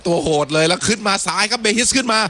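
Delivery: rising, excited commentary as the attack builds; live play-by-play. Real, not acted. Commentator calling a left-wing attack to listeners, excited play-by-play.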